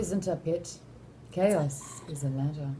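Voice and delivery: low, soft, slow menace; hushed villain quote. Acted. Quoting Baelish's monologue to co-hosts, hushed. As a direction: murmur it slowly and low.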